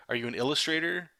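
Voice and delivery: normal talking voice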